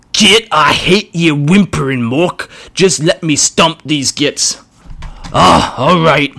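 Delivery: a brutish orc taking his time. Acted. Orc arguing with himself.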